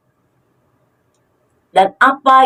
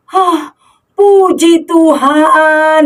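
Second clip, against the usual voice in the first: slow, gasping puji Tuhan; relieved gasp; 4 semitones higher, much slower than usual. Pretend. Rider catching breath at the cliff edge, gasping relief. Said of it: "narik napas saking deg-degan".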